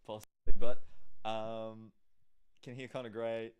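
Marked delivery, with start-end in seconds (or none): 0.1-0.8 same word again, much louder into the mic; louder repeat. Shown as a demonstration. Co-host repeats it louder while testing new mics. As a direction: punch it out for the volume check.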